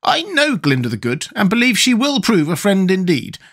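high-pitched character voice; Nick Chopper's voice. A narrator's reading. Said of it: "declared Nick Chopper".

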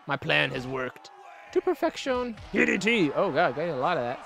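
ordinary talk, nothing marked

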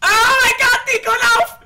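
high frantic yelling; panicked shouting. Real, not acted. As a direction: yell in sudden terror. Ghost hunting, friend about to die.

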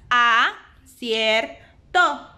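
"a, cier, to" split into separate beats; syllable by syllable. Shown as a demonstration. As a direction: spell out "a-cier-to" slowly, syllable by syllable. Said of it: "tiene tres sílabas". (separando sílabas)

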